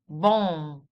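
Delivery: Bom with a long nasal vowel and no closing m; open-lipped bom. Shown as a demonstration. Teacher demonstrating Bom without closing the lips. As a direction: stretch the vowel of Bom, lips never shut. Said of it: "you don't close the lips in the end".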